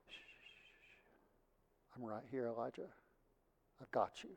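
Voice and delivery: very soft, hushed comforting; calm a frightened son, close and hushed; God soothing Elijah in a near whisper; gentle whisper. Retold or imitated. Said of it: "in the most peaceful manner".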